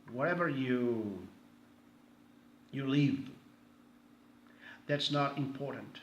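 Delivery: ordinary talk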